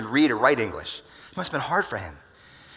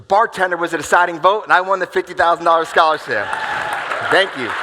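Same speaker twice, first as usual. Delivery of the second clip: loud, higher triumphant announcement; 6 semitones higher, 13 dB louder than usual. Real, not acted. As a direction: belt out the win. Triumphant end of story.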